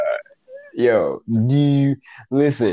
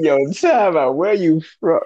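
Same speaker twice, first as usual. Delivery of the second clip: higher, louder mock challenge; mock disbelief; 7 semitones higher, 4 dB louder than usual. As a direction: call a sudden time out in disbelief. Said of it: "I was just like".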